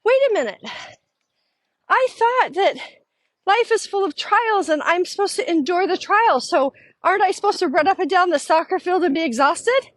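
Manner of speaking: high, bewildered voice; high and baffled, questioning it; imitating a baffled friend; puzzled objection. Friend's confused objection, retold.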